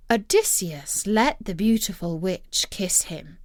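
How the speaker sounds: plain reading voice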